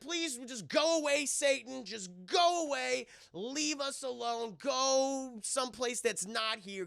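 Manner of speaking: high, slow, drawn-out begging; hammed-up pleading grace; pleading prayer. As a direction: pray pointedly at the thing to leave. Grace aimed at the hand.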